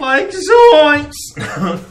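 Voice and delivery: very high, loud cartoon alarm; hammed-up cartoon fright; cartoon sidekick yelp. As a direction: yelp it like a Scooby-Doo character. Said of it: "Scooby-Doo on that".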